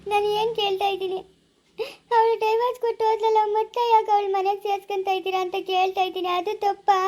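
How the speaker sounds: high, tearful protest; tearful complaint. Acted. Wife complains to husband through tears, high and tearful. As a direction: plead through tears that everyone blames her.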